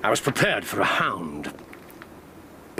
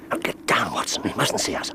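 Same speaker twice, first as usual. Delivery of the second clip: normal talking voice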